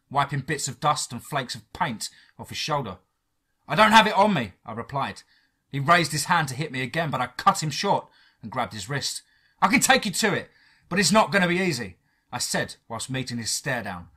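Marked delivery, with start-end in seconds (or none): 3.7-4.5 raised, loud denial; high, emphatic refusal; defiant reply. Acted. Defiant reply to the threatening agent. 9.6-12.0 high, loud, insistent offer; raised, urgent negotiation; firm bargaining. Acted. Bargaining firmly while staring agent down.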